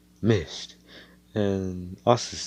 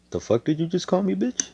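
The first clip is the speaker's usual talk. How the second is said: much higher, disbelieving challenge; mock offended; 10 semitones higher than usual. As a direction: act insulted and dare him to repeat it. Hero offended by insult.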